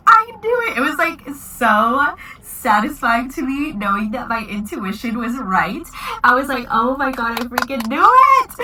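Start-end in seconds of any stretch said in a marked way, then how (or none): 0.1-0.8 excited. Retold or imitated. Excited reliving proposal reveal. 6.7-8.5 excited. Retold or imitated. Reliving knowing the proposal.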